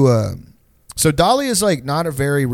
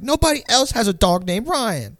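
imitating a kid's higher-pitched insistence; raised mock-kid voice making a proud argument; Jaden; 7 semitones higher than usual. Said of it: "It's like a kid, like a kid logic".

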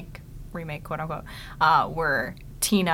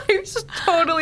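laughing. Retold or imitated. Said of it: "I was like".

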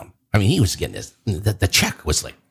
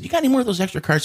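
high, quick cheeky request; pitched-up playful ask; 13 semitones higher, much faster than usual. Retold or imitated. Asking the usher for more ejection cards, cheeky. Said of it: "I said".

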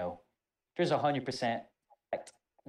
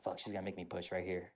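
much softer dreading mutter; worried inner mutter; 4 semitones lower, 6 dB quieter, faster than usual. Retold or imitated. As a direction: mutter it under your breath, dreading push-ups. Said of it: "I'm like".

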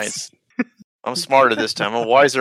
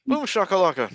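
higher, pitched-up NBA Jam catchphrase; hype announcer call; 6 semitones higher than usual. Retold or imitated. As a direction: toss it up like a game announcer. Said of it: "Get the N B A Jam guy".

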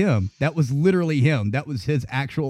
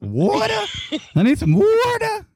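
much higher, exaggerated "water" with the R; mock accent on water. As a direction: squeal "water" with an overdone R. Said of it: "you're putting an extra R in there".

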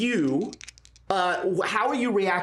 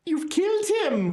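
high accusing cry; 10 semitones higher than usual. Acted. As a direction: point at them in horror. Woman accuses in scene.

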